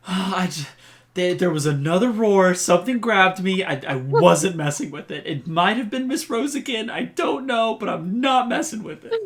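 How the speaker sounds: put-on high, breathless, rattled young voice; panting out a frightened excuse; shaken kid. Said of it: "heaved over, hands on my knees from running".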